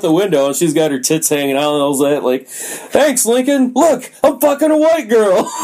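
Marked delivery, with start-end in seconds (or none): 2.5-5.5 very high-pitched mock holler, laughing at the end; squeaky-high imitated shout, breaking into laughter; gleeful calling out. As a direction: imitate someone bragging gleefully out a window. Man calling out of a window.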